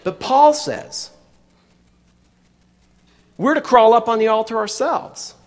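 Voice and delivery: ordinary talk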